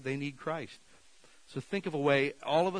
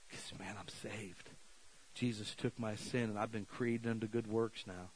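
soft testimony; 3 semitones lower, 6 dB quieter than usual. Pretend. Imagined testimony to a questioner.

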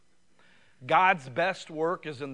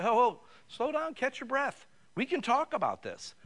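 soothing dad, pitched up and gentle; calming a crying daughter; 9 semitones higher than usual. Retold or imitated. Dad calming his crying girl mid-protest, soothing and higher.

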